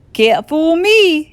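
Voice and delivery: slow, loud and smug. A narrator's reading. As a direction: sure of himself, pleased. Smug Blansworth.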